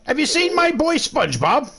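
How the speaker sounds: squeaky cartoonish caller voice; high silly prank voice. Prank caller asks a stranger for SpongeBob, squeaky cartoon voice. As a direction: play a high-voiced stranger hunting for a lost friend.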